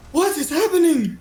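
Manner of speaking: high, panicked outcry; alarmed crowd. Acted. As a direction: cry out as a horrified onlooker. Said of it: "the whole crowd goes".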